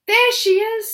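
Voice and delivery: put-on high, loud excited child; excited girl. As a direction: spot her with joy. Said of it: "said Rose".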